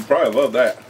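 snacking between words; chewing while talking. Real, not acted. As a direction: mouth partly full. Seen 1: Man holds crouton bag, eats before and after, hand near mouth.

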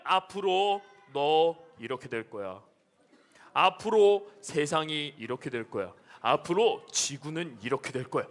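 very low, slow monotone; ominous prophecy voice. Acted. Pastor voices Revelation foretelling the world's future, ominous. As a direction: intone it deep and slowly like a prophecy.

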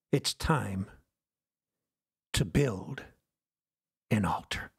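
low, with long pauses between phrases; solemn, measured call. Real, not acted. Preacher calling the church to build an altar, solemn and measured. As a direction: lay out each phrase separately and low.

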